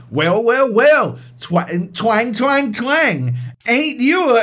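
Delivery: high, loud mock twang; Thor Sluggerson. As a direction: ham up a drawling ballplayer's praise. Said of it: "he goes".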